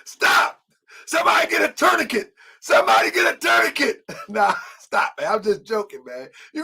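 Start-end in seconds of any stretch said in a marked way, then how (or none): 0.1-4.1 high, fast, loud call for help; mock panic shout. Imitating Kadar yelling for a tourniquet, high and fast. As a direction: yell for a tourniquet like it's an emergency. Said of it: "Kadar say".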